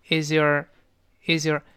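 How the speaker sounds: is your run together as "iz-yer", slowly; linked is your. Shown as a demonstration. Teacher demonstrates linking to learners, is your joined as "iz-yer". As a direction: link the z of is into a weakened your. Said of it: "is跟your会连读".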